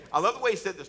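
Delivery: ordinary delivery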